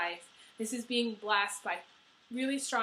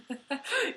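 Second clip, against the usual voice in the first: short laugh; 5 semitones higher than usual. Real, not acted. Laughs at her own joke.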